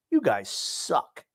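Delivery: slow, drawn-out scorn; retold disgust. Fan watching other teams' goaltenders, drawn-out contempt. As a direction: stretch it out in mock disgust.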